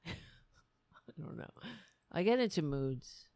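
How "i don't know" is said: laughing whisper; slow, hushed, self-conscious giggle. Real, not acted. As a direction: mutter it under a laugh. Embarrassed aside.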